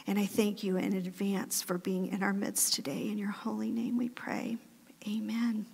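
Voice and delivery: soft prayer fading to a whisper at the end; opening prayer. Acted. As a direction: pray softly, ending in a hushed whisper.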